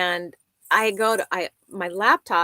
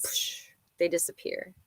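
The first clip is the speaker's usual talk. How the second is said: whisper; 8 dB quieter than usual. Real, not acted. Disappointed aside.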